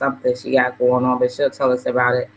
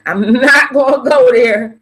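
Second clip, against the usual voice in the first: fired up; 7 semitones higher, 4 dB louder than usual. Real, not acted. Heated emotional rant to viewers.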